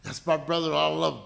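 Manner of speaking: ordinary talk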